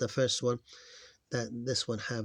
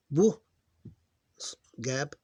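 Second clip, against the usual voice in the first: ordinary talk, nothing marked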